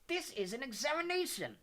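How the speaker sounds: high-pitched, scandalized professor. A narrator's reading. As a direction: protest in shocked disapproval. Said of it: "in a scandalized voice".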